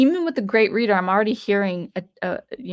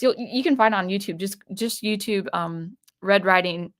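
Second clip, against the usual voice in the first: ordinary talk, nothing marked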